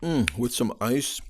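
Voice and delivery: talking while crunching ice; words around a mouthful of ice. Real, not acted. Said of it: "You can hear me crunching it".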